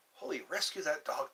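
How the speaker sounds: ordinary talk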